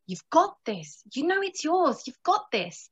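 quiet, reassuring inner voice. Retold or imitated. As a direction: soothe yourself into focus. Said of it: "my higher mind is saying".

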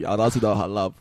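normal talking voice

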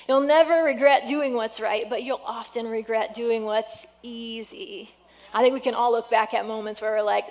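normal talking voice

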